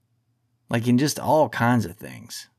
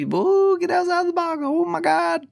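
much higher, mock-gasping falsetto. Mocking the easily shocked audience, high squealing falsetto. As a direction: squeal it like a scandalized prude. Mocking edgy content makers.

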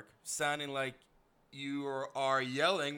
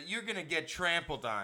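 usual speaking voice

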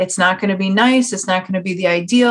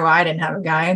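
ordinary talk

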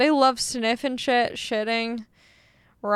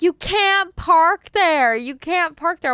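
higher-pitched mock neighbour's holler; imitated yelling; 6 semitones higher, 3 dB louder, slower than usual. Lady across the street to driver, imitated yelling. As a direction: call it out, stretched at first. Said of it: "she's, like, yelling at me".

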